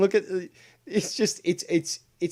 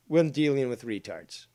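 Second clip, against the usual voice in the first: normal talking voice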